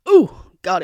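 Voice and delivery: a loud blissful ooh; delighted exclamation. Real, not acted. Raving to viewers about lychee ice cream, overjoyed.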